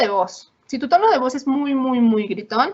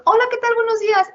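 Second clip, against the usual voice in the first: much higher, louder bubbly hello; over-eager greeting; 11 semitones higher, 4 dB louder than usual. Retold or imitated. Over-eager person greeting a stranger, pitched far up.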